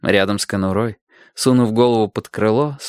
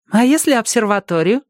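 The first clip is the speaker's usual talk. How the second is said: put-on high, needling child's question; teasing dig; 13 semitones higher, 4 dB louder than usual. A narrator's reading. Girl teasing the old man about the observatory, high and needling. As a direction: needle him in a high girl's voice. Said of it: "поддела старика Динка".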